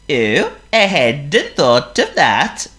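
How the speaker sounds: high, loud, slow seal voice; Honk the seal. Acted. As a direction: play a polite silly seal. Honk's voice.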